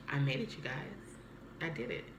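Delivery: quiet private triumph; tender, moved. Retold or imitated. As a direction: say it softly to loved ones who are gone. Speaking to late relatives, moved.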